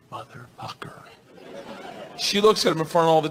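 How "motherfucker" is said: unvoiced whisper under the breath; reluctant whisper. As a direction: whisper the forbidden word reluctantly. Reluctantly says the safe word.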